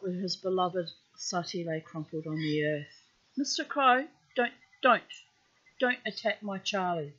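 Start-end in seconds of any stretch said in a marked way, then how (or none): 3.4-7.1 higher, louder shout at the crow; calling out a warning. Real, not acted. Reader warns the crow away from Charlie, loud warning call. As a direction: shoo the bird off sharply.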